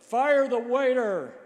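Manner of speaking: high, loud, drawn-out joking heckle; yelled out. Retold or imitated. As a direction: shout it across the hall as a joke. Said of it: "one fellow yelled out".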